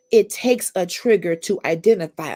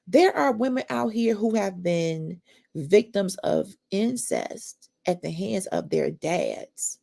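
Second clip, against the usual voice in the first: normal talking voice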